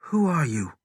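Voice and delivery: ordinary delivery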